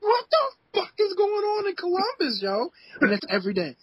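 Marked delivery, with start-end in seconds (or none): 0.0-2.7 high, strained cry of disbelief; exasperated outcry. Retold or imitated. Complaining about Columbus weather, high exasperated wail. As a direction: throw it up at the sky in despair. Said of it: "I'm like".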